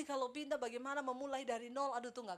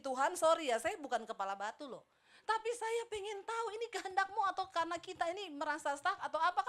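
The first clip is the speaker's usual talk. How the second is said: raised, loud plea to God; acted plea to God; 5 semitones higher, 6 dB louder than usual. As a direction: argue earnestly with God, pitched up. Retelling own plea to God.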